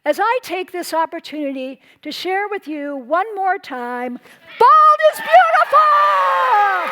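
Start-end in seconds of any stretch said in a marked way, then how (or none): 4.6-6.9 slow, high, shouted rallying cry. Real, not acted. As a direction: shout it out to the whole room. Closing rallying cry to audience.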